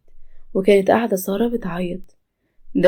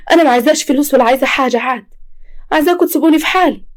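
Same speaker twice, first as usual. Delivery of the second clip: raised, fast, higher angry refusal; furious rejection of the money; angry outburst; 6 semitones higher, 5 dB louder, faster than usual. Acted. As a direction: push them away with a fast high yell. Sara refusing money, shouting.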